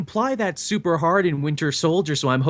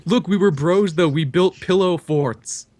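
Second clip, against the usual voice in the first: louder, slow pleading buddy; pleading Steve voice; 4 semitones higher, 4 dB louder, slower than usual. Retold or imitated. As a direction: beg like an old friend. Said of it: "playing Steve pleading".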